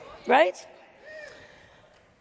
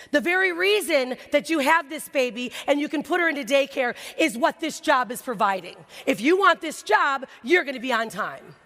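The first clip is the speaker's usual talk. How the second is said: stern lecture; 4 semitones higher, 3 dB louder than usual. Retold or imitated. Boss lecturing a new mother, loud and stern. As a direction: drive each word home like a harsh boss.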